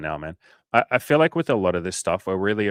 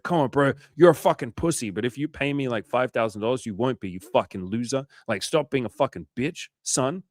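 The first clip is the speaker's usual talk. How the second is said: mock pushy salesman; 4 semitones higher than usual. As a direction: play a pushy salesman badly harassing a client. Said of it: "Imagine if I called you guys fifteen times and I was like".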